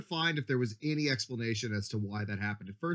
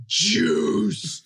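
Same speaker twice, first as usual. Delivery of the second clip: loud high shout; loud yell; 8 semitones higher, 8 dB louder than usual. Retold or imitated. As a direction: bellow the word out. Echoing yell.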